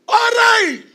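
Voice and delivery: high, loud call from above; Mical shouting. Acted. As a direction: call down from the window in scorn. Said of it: "y grita desde arriba".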